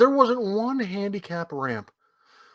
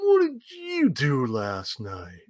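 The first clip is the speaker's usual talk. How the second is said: slow, drawn-out, lower teasing question; slow, put-on knowing interrogation; mock accusing; 4 semitones lower, 4 dB quieter, much slower than usual. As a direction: draw out each word to coax a confession. Re-enacts prodding friend into confessing.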